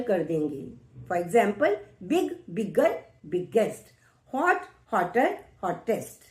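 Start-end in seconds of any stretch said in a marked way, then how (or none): none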